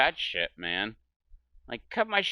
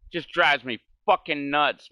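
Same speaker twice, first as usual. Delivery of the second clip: ordinary delivery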